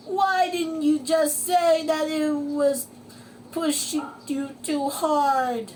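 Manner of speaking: high, distressed wail; SpongeBob crying out. Acted. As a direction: plead in dismay with your pet. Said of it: "cried SpongeBob".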